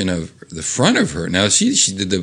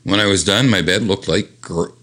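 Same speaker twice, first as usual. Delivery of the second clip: ordinary delivery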